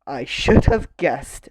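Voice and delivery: slow, loud character line; slow, smiling storybook read; Mister Clever. A narrator's reading. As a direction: draw it out knowingly. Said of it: "smiled Mister Clever".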